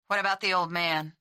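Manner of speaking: guarded, cold question; chilly wariness. A narrator's reading. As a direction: let coldness creep in, keep the pitch down. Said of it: "A coldness had crept into Steve's voice".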